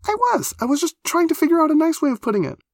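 very high, loud, rushed defence; high-pitched, hurried self-justification; flustered protest. Acted. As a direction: insist urgently in a high voice.

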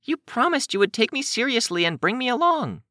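put-on very high, insistent girl voice; high-voiced, indignant child; pleading Kenzie. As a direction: press the complaint, pitched high.

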